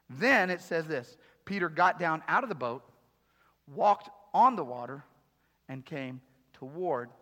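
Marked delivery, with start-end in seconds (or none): none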